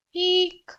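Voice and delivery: very high eek; squeak. Acted. Tom as the bees come in, very high squeak. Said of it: "squeaked Tom".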